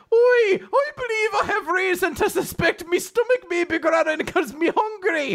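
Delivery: very high-pitched mock Irish; Captain Connor. Captain with growling stomach announces hunger, hammed-up Irish.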